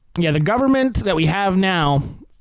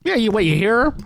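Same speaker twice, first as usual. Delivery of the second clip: ordinary delivery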